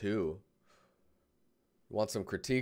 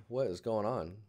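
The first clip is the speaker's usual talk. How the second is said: ordinary talk, nothing marked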